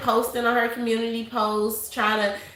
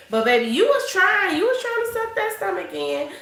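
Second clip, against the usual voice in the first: teasing; 9 semitones higher, 3 dB louder than usual. Real, not acted. Teasing woman sucking stomach in.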